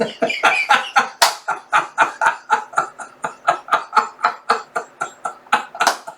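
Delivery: long wheezing giggle fit; breathless high, voiceless laughing; real laughter. Real, not acted. Laughing at child's garbled answer, real wheezy laughter.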